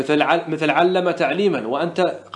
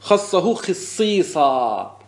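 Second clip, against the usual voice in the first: slow demonstration; much slower than usual. Shown as a demonstration. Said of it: "سمعنا العرب تقول".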